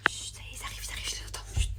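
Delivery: urgent hushed whisper; whispering while hiding. Real, not acted. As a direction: breathe it out so they can't hear. Seekers arriving, hiding.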